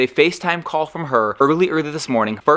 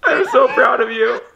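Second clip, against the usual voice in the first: tearful; 8 semitones higher than usual. Real, not acted. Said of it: "overwhelmed by Dad's tears".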